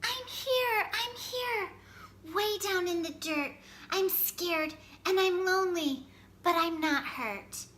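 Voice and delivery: high, small scared voice; small seed voice. A narrator's reading. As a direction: call up high from under the dirt. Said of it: "came Little Seed's muffled voice".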